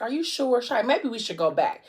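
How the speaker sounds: retold worried check, starting high; mildly nervous. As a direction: voice her second thoughts with a touch of nerves. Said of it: "because now she done got nervous".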